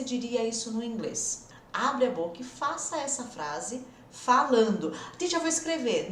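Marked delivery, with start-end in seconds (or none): 5.2-6.1 lightly voiced student, higher pitch; a slightly higher put-on pupil voice; student objecting. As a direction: mimic an eager student interrupting teacher.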